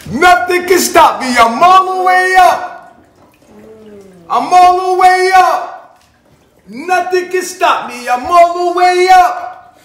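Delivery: loud, slow, high power-up cry; triumphant shout. Pretend. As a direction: boast like a rising villain.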